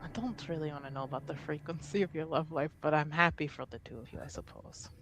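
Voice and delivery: usual speaking voice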